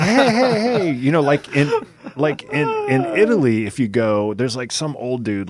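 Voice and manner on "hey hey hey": mock friendly greeting. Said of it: "they're not like".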